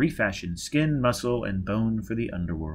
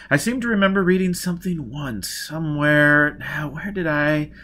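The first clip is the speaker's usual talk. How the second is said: absent-minded groping for a memory; searching memory; 5 semitones higher, 5 dB louder than usual. Acted. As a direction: think aloud, stretching the words. Baker searching his memory aloud.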